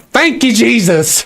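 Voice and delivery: high, mocking thanks to Jesus; mock grateful believer. To the streamer, mocking his prayers, high mock thanks. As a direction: squeal it like a smug believer. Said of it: "you're like".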